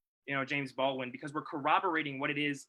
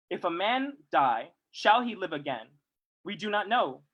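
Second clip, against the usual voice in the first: solemn reading; 5 dB louder than usual. Acted. Speaker reads a Du Bois passage to the audience, solemn. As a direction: read it like scripture.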